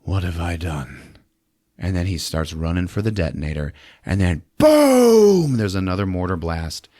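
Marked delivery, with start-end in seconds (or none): 0.0-1.2 quiet realization. Retold or imitated. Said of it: "and he says". 4.6-5.6 a slow, loud, high drawn-out boom; explosion boom. Retold or imitated. Imitating the explosion.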